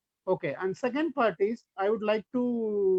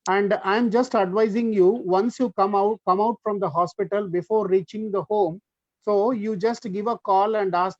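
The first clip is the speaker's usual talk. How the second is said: projected, louder lecturing voice; little louder; 7 dB louder than usual. Real, not acted. Doctor advising colleagues on leaving hospital, a little louder. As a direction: speak up firmly for the listeners. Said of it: "I'll do it with little louder".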